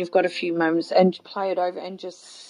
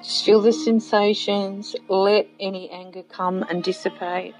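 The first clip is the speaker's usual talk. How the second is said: guided meditation. Acted. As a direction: gently lead them inward. Leads a guided visualization.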